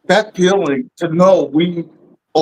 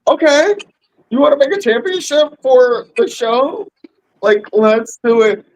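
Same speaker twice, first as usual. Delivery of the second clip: excited quote; 7 semitones higher than usual. Retold or imitated. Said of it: "We were just like".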